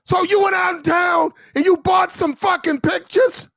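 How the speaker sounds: mock officer yelling. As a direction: bellow at the sailor like a furious lieutenant. Lieutenant shouting at sailor.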